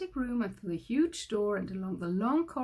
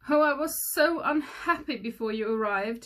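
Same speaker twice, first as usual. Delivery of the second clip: higher, louder wail; hammed-up tearful wail; the king wails his misery; 4 semitones higher, 7 dB louder than usual. A narrator's reading. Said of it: "he wailed".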